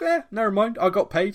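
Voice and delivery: hammed-up indifference; mock careless shrug. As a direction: shrug it off in a put-on voice. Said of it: "absolute shrug".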